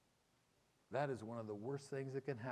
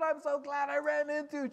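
put-on high, loud girlish voice, much higher than usual; girl's voice; 14 semitones higher, 7 dB louder than usual. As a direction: play the excited young woman, high and bright. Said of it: "and she's like".

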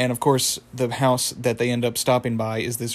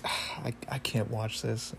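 hushed, much softer retold disgust; queasy mock groan; 10 dB quieter than usual. Friend can't watch the raw egg eaten, queasy and hushed. Mordecai grossed out by egg eating.

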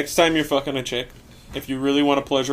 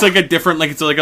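usual speaking voice

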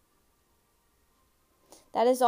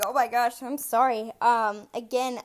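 normal talking voice

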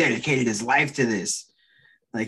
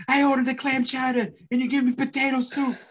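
much higher, louder whiny complaint; whiny complaining customer; 14 semitones higher, 4 dB louder than usual. Retold or imitated. Co-host mocking the wrestler complaining about soup, high whiny voice. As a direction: pitch it way up like a fussy diner. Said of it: "He's like".

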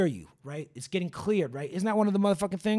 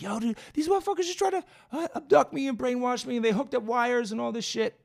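high, frantic retelling that eases as it goes; crazy, panicked; 8 semitones higher, 3 dB louder than usual. Retold or imitated. Young man phones his friend after bolting, frantic and crazy-sounding. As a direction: blurt it high like you're bugging out. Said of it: "man, you sound crazy".

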